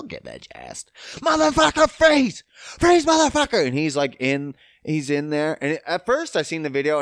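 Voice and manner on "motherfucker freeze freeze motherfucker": high, loud retold yelling; paraphrased cop shout. Police officer yelling at the driver to freeze. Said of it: "I'm paraphrasing".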